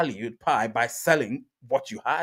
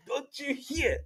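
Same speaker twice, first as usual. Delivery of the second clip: much higher, slow mock voice; quoting a commenter; 11 semitones higher, 9 dB quieter, slower than usual. Reading a viewer's comment in a high put-on voice. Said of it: "Success here says".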